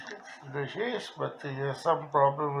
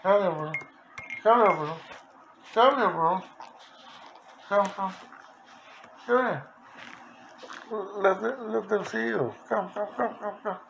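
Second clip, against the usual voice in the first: higher, sing-song calling to the bird; calling the pet; 6 semitones higher than usual. Real, not acted. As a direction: coax him closer, high and friendly.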